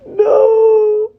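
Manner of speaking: high, loud crying wail; real crying. Real, not acted. Crying at the hero's death.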